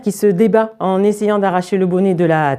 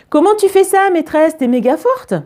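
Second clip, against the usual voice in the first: high-pitched wide-eyed pupil; awed little-kid exclamation; admiring child; 10 semitones higher than usual. Acted. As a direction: marvel at your teacher in a high child voice. Admiring pupil voiced in story.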